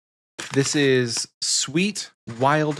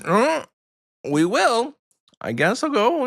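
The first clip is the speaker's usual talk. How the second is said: high-pitched coy put-on voice; mock reluctance; 11 semitones higher than usual. Hosts act out answering a gift email, high mock-reluctant voice. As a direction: accept the gift as if grudgingly, pitched way up. Acting out coy reply to sponsor.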